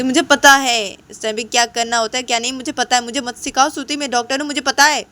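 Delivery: high, insistent outburst; angry yelling. Acted. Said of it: "इस time पे ऐसे नहीं चिल्लाते". (चिढ़कर ज़ोर से जवाब)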